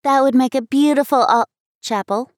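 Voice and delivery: put-on girl delighted by the chapel idea; excited exclaim. A narrator's reading. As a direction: burst out in delight, then stumble. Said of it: "exclaimed Abby".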